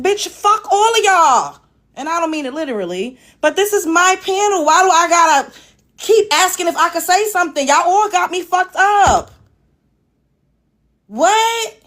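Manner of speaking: high-pitched indignant outburst; real irritation. Real, not acted. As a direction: stretch the key words in exasperation. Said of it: "It's so irritating".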